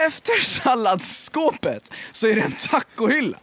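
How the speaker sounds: slow, deliberately spaced restatement; slow emphatic restart. Real, not acted. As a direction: lay it out slowly after being interrupted. Restarts emphatically after being interrupted. (långsam, tydlig omtagning)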